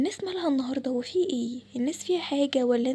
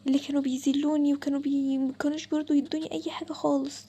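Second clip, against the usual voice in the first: usual speaking voice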